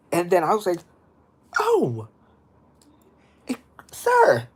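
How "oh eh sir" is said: high-pitched mock alarm; retold startled reaction. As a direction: yelp it as if caught off guard. Retells startled reply to scare actor.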